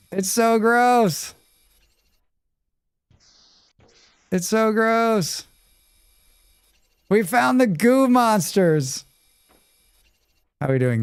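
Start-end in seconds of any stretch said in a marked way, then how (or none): 0.1-5.5 high, slow drawn-out disgust. Real, not acted. 7.1-9.0 high, slow playful announcement; excited announcement. Real, not acted.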